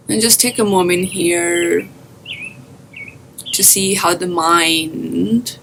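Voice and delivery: guided meditation. Acted. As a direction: guide listeners gently into their breath, drawing out phrase endings.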